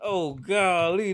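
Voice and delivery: normal talking voice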